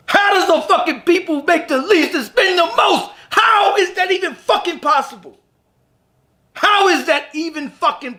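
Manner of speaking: real exasperated yell; incredulous shout. Real, not acted. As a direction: demand an answer in disbelief. Ranting about money habits.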